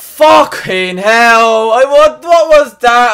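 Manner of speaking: loud, drawn-out, outraged; angry outburst. Real, not acted. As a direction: blurt it out in disbelief. Red card given.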